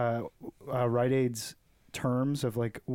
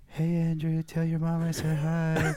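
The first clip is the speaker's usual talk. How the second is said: slow, higher put-on creepy voice; Daniel; 4 semitones higher, slower than usual. Creepy store worker greeting a boy about his mom, imitated slow and high. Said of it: "That's how he sounded".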